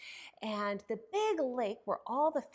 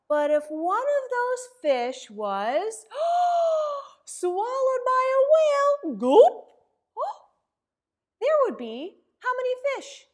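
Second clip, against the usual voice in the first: gasping, much higher and louder whale drama; dramatic gasp; 12 semitones higher, 13 dB louder than usual. Acted. Librarian acting shocked as the whale swallows a fish, gasping. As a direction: make the kids jump at the whale.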